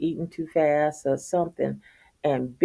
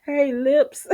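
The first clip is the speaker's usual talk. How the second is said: high, sing-song greeting; teasing; 9 semitones higher than usual. Retold or imitated. Husband teasing his wife, high mocking greeting. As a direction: call it out mockingly. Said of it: "He said".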